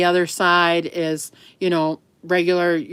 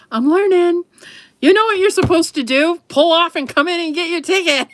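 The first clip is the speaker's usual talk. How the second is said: higher, louder, flustered apology; flustered and laughing; 11 semitones higher, 4 dB louder than usual. Retold or imitated. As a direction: babble it out, embarrassed but laughing. Retelling flubbed apology at scale.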